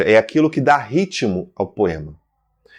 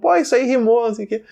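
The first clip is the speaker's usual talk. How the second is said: higher-pitched mock everyday chatter, fading off; quoted offhand exclamation, pitched up; imitating casual remark; 10 semitones higher, faster than usual. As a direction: toss it off like someone casually noticing a rhyme. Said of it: "o tempo inteiro nós falamos".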